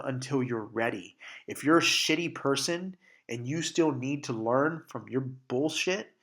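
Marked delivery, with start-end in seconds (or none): none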